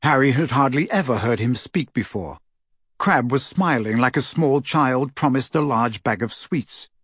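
plain reading voice

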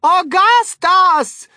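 very slow, high, loud shout of a name; long-drawn shrieked call. A narrator's reading. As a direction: stretch the name into a mother's yell. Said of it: "shouted Missus Gloop".

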